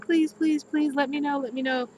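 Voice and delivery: high-pitched imitation of eager begging; mock pleading. Astrologer quoting viewers who want classes, mock pleading. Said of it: "begging, asking, pleading, saying".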